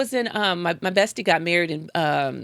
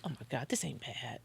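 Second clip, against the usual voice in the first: very soft, lower-pitched aside; private murmur; 5 semitones lower, 17 dB quieter than usual. Retold or imitated. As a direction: think it aloud under your breath. Retelling private thought while driving.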